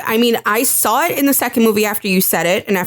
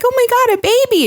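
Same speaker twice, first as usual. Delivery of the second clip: put-on much higher and louder, squealing over a baby; gushing delight; 4 dB louder, faster than usual. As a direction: burst out like you just saw a baby. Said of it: "She's just like".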